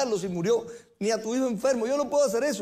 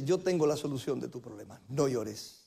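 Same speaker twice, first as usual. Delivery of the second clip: tender consolation; 9 semitones lower, 6 dB quieter than usual. Acted. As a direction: lower and softer, gentle reassurance. Jesus voiced consoling woman.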